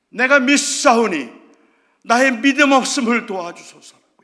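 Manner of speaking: loud desperate plea; father crying out. Acted. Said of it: "아버지가 소리를 질러요".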